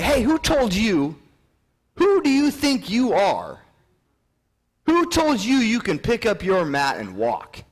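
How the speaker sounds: high, loud, drawn-out accusation; indignant challenge. Acted. Pharisees confronting the healed man, indignant. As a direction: confront him loudly, stretching the words.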